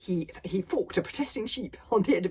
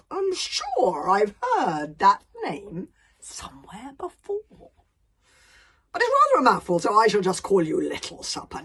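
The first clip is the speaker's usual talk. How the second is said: a giant savouring every word of his philosophy; pompous dragon philosopher; 3 semitones higher, 11 dB louder than usual. Acted. Dragon lecturing Hiccup on life, loud and grandiose.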